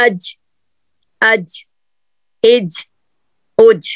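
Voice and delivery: اج with the middle of the tongue against the palate; isolated letter demo. Shown as a demonstration. Teacher sounding out اج for practice, short and stopped. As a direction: stop hard on اج, mid-tongue pressed up to the roof of the mouth. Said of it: "اب آپ practice کر کے دیکھیں".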